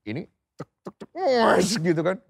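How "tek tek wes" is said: ticks into a loud, drawn-out whoosh; spoken sound effects; imitated motor sounds. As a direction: mimic clicks, then a big high swoosh.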